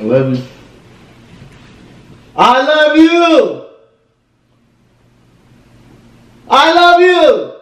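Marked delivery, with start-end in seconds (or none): 2.4-7.5 stretched high and slow, pleading; sing-song begging to win her over; high drawn-out plea. Acted. Boyfriend begging silent girlfriend, high and slow.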